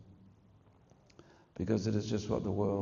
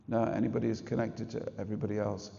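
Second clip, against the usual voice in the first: normal talking voice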